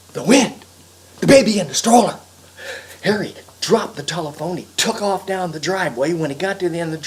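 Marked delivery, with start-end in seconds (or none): 0.1-2.2 loud, higher burst of panic; sudden alarm. Acted. Harry remembers the baby in the stroller, alarmed cry. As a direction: cry out as it hits you.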